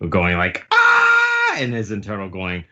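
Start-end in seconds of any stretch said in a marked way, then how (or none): 0.7-1.6 very high, loud mock scream; terrified scream. Queen faces the awakened Big Mom, high terrified scream. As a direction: scream like a panicking cartoon villain.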